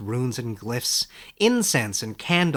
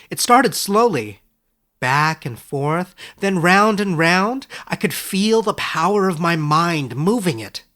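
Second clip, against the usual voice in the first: higher, louder, awed and eager; Edric, thrilled; 6 semitones higher, 7 dB louder than usual. Acted. Young scholar tells his teacher of his power, thrilled and higher. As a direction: let the wonder lift the voice.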